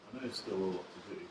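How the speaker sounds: usual speaking voice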